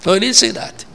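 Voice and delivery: ordinary talk, nothing marked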